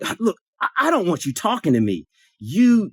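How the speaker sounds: high-pitched, irritated mimicry; mock huffy telling-off; hostile rebuff. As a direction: imitate someone indignantly warning you off. Acts out woman's hostile rebuff.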